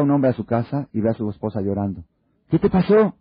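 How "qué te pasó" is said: high, urgent "¿Qué te pasó?"; husband alarmed at wife's tears; worried husband. Retold or imitated. Husband finding his wife crying, asking urgently.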